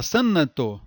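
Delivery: "sanato" com N de consoante, sâ-na-to; pronúncia errada. Shown as a demonstration. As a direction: solte o N de "sanato" como sílaba própria. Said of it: "Não fala". ("sanato" como santo com "nâ" no meio)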